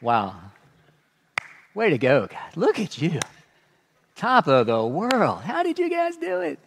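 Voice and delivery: mock congratulations, dry and ironic; slow-clap sarcasm. Paul slow-clapping the Corinthians, mock praise. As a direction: applaud them sarcastically, a leap up on the last question. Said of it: "doing a slow clap".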